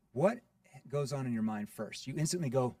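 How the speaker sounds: ordinary delivery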